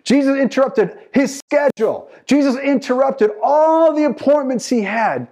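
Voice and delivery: high, driving sermon delivery; impassioned preaching. Real, not acted. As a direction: preach it out with mounting insistence. Preaching with rising force.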